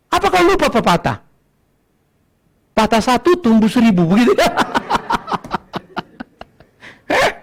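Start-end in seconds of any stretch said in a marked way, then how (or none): 4.4-6.4 high breathy laugh. Real, not acted. Laughs at his own joke.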